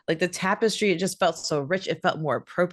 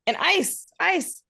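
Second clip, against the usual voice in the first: excited; 9 semitones higher, 6 dB louder than usual. Real, not acted. Hosts excitedly name the sword.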